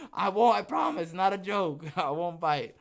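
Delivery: loud, high, laughing reassurance; laughing promise. Real, not acted. Podcast host laughing as he promises chat he won't bite.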